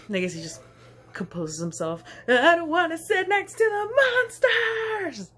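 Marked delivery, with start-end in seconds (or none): 2.3-5.3 put-on high, loud childish wail; whiny toddler. Toddler telling his dad he won't sit by the monsters, whining loudly. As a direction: pout it out like a scared little kid.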